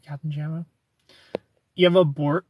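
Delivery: ordinary delivery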